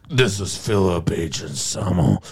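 [name]. Shown as a demonstration. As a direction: draw it out like a swaggering metal frontman. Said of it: "Evan started doing an impression of [name]".

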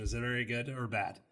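usual speaking voice

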